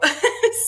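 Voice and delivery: a quick high laugh. Real, not acted. Laughing at herself after learning not to tell Jan no. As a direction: laugh it off, amused.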